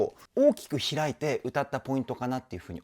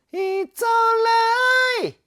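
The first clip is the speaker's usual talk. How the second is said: It's alright in head voice with some chest tone; mixed voice; 8 dB louder than usual. Shown as a demonstration. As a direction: add a little chest to the falsetto. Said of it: "ちょっと地声の音色を足すと". (地声を混ぜたミックスボイス)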